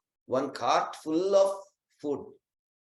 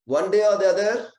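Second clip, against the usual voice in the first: daughter pleading; 6 semitones higher, 8 dB louder than usual. Acted. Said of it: "Then the daughter says".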